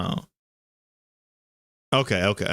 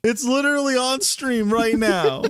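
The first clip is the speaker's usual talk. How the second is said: high, laughing exasperation; exasperated protest; 8 semitones higher than usual. Real, not acted. Streamer pleading with a doubting co-host, high exasperated protest. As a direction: plead your case in a raised pitch.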